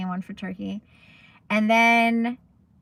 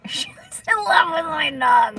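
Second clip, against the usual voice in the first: higher, louder laughing burst; gushing laugh about the dog; 8 semitones higher, 7 dB louder than usual. Real, not acted. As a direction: laugh it out, delighted. Laughing about baker loving dog.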